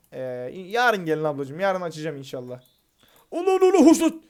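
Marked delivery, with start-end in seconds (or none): 3.3-4.2 much higher and louder startled cry; sudden loud high yelp of surprise; startled shout. Real, not acted. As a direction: shout out in shock as the power cuts. Power cut, startled outburst.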